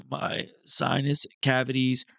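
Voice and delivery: usual speaking voice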